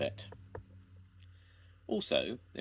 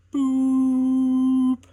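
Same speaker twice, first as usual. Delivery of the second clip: high long blasting toot; very loud whistle; 15 dB louder than usual. A narrator's reading. Ivor silencing the shouting crowd, long loud high toot. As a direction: drown everyone out. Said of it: "so loudly that everybody had to stop".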